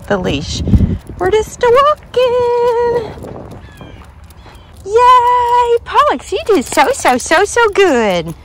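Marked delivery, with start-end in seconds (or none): 1.2-3.3 high, slow, stretched-out lilt; playful singsong. Real, not acted. Dog mom pleased with the loose leash, playful singsong. 4.8-8.3 high cheer settling down to usual pitch; gushing praise. Real, not acted. Dog mom praising her dog for a good walk, gushing.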